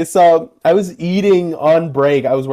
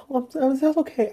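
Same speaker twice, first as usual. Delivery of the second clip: mock meek voice; 10 semitones higher, 6 dB quieter, much faster than usual. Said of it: "I wasn't like".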